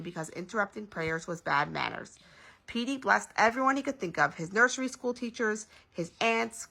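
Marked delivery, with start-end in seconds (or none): none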